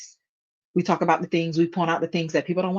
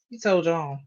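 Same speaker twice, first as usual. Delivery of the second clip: ordinary delivery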